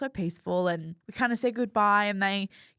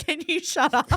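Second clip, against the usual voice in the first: higher, teasing annoyed mother; mock exasperated mum; 8 semitones higher, 4 dB louder than usual. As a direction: tease her as the fed-up mum. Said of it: "she just would have been like".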